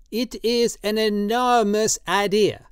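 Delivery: put-on high, slow, pleading old man's voice; old tattooist Drioli, drunk and pleading. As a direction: coax him tenderly, in wine.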